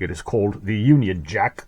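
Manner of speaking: "Union Jack" in a hammed-up British accent; name the flag with mock British flair. Presenting the flag to viewers in a mock British accent. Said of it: "I can't do that for that accent".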